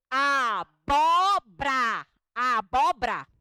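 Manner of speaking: "Abóbora" very slow and loud, each syllable stretched; drawn-out abóbora. Shown as a demonstration. Teacher drilling the class on abóbora, slow and loud. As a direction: sound every syllable of "Abóbora". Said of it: "vamos falar a língua portuguesa certa".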